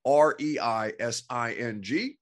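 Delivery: slow letter by letter; spelling it out. Shown as a demonstration. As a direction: spell each letter clearly. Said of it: "spelling handle".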